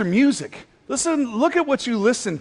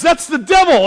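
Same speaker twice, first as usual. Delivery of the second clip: much higher, louder hippie warning; hippie alarm; 9 semitones higher, 7 dB louder than usual. Retold or imitated. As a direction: blurt it out like he's found proof. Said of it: "one of these old hippie dudes".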